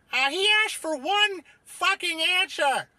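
high, loud put-on scolding voice; exasperated character outburst. Panelist scolds host for waffling, exasperated shout.

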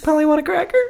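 high squawky parrot line. Pretend. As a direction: chirp it like a pet parrot. Parrot voice continues.

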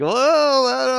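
high, loud backing-off whoa; playful mock protest, pitched high; mock alarmed whoa. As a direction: throw up your hands and back off. Miming a shrug at wife.

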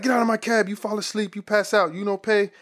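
an irate driver ordering his passenger out, voice up high; yelling cab driver. Retold or imitated. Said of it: "he yelling in, uh, Arabic and shit".

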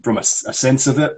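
ordinary talk

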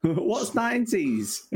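high, slow, laughing mock ignorance; mock youngster. Mocking youngsters who never knew the nineties, laughing. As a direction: play the clueless youngster, laughing. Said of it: "mocking the young".

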